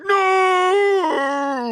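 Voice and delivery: high crying shout; despairing no. Retold or imitated. Steve realizes the mask is his skin, high crying shout. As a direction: wail at the truth. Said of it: "And he's like".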